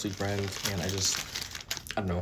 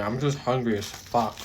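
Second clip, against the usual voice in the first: usual speaking voice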